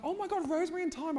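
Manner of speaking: excited. Retold or imitated. Excited recognition of old show.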